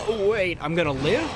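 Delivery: high, loud replay of surprised relief; sudden hope. Retold or imitated. Retells sudden hope of surviving.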